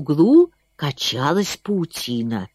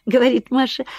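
ordinary delivery

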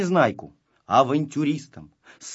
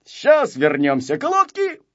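high, loud, confident rallying; rally your friend with full assurance; raised, can-do voice; 10 semitones higher, 7 dB louder than usual. A narrator's reading. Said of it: "сказал Незнайка уверенно".

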